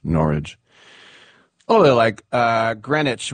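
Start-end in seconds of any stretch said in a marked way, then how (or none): 0.0-0.6 Norwich said as NOR-idge; local pronunciation. Shown as a demonstration. Host teaching the English name, Norwich as NOR-idge. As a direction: Norwich rhyming its ending with spinach. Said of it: "but we say".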